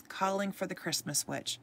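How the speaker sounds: usual speaking voice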